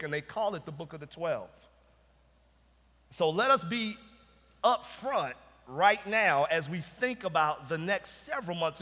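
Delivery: forceful preaching. Acted. Pastor introducing the minor prophets series, full-voiced preaching. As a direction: preach it to the whole room with conviction.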